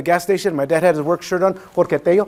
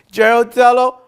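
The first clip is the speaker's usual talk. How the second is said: a forced loud call-out, higher and much louder; loud on command; 7 semitones higher, 9 dB louder than usual. Retold or imitated. Schoolboy told by teacher to use his big voice, loud. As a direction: belt the name out to the whole class. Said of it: "say it out loud".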